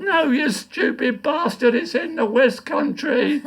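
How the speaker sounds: high, slow, scornful retort; a hint of a grumpy old man putting him right; mock exasperated old man. Dying man insults him.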